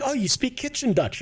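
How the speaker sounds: high-pitched mock Dutch person. Dutch person sneering at a South African, high mocking voice. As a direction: put on a high, needling voice. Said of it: "They're like".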